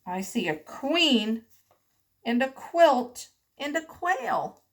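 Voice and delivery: ordinary talk, nothing marked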